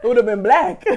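loud, laughing through the words; chuckling through the joke; laughing while talking. Real, not acted. Teasing her cohost about her liver, laughing loudly.

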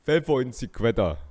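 forceful mock German-accented Spanish. Shown as a demonstration. Showing the son's catchphrase, loud German accent. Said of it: "Den deutschen Akzent".

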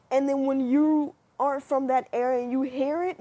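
normal talking voice

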